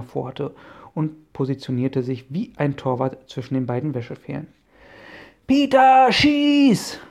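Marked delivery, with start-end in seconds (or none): 5.5-7.0 very loud, high yell; bellowing across the field. Acted. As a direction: shout it across the whole yard. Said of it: "brüllte Bob über den Platz".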